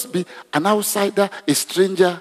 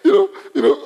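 high-pitched words through laughter; laughing; 12 semitones higher, 4 dB louder, faster than usual. Real, not acted. Preacher recovering from his joke, still laughing. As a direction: still giggling, trying to go on.

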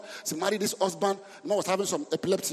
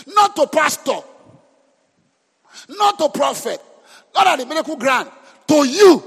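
higher, louder punched phrases; shouted emphasis; 6 semitones higher, 10 dB louder than usual. Real, not acted. Preacher shouts that faith belongs to the hearer. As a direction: hammer each phrase.

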